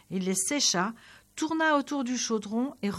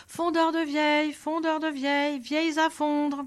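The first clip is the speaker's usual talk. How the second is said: higher crier's singsong call; street crier's call; 5 semitones higher than usual. Acted. Old Nicolas calling out to the town square, mild crier's call. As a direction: hawk it like an old street peddler. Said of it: "se mit à crier sur la place".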